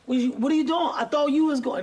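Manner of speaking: high, loud frantic complaint; mock panicked player; panicked corner. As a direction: blame the safety in a panic.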